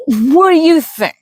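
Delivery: high, loud slurred retort; drunken slur. A narrator's reading. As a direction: slur it with arms wide. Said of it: "he slurred".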